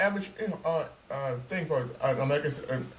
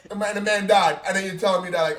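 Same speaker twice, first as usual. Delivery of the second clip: ordinary talk, nothing marked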